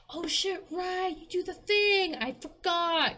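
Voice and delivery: mock the coworker's dawning realization, pitched high; high drawn-out coworker remembering; imitating coworker. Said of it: "And they were like".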